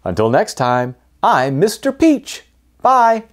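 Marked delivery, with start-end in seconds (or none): none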